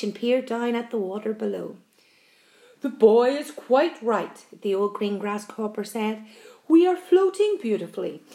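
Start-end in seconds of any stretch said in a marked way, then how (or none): 2.8-4.4 put-on slow, measured old grasshopper; deliberate, composed elder. A narrator's reading. Old grasshopper reassures everyone. 6.7-8.2 high, stately grasshopper reassurance; composed, declamatory comfort; old grasshopper. A narrator's reading.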